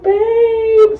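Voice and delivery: very high, drawn-out whimper; hammed-up crying plea; mock tearful whine. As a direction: wail it like a needy drunk. Crying self greets her boyfriend.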